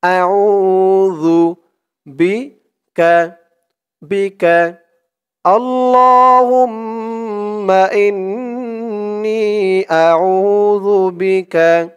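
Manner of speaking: slow, drawn-out recited Arabic; model recitation. Shown as a demonstration. Teacher models the word for viewers to repeat, slow recitation. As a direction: stretch every syllable for them to copy. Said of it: "জালের উচ্চারণটা একদম নরম পাতলা হবে".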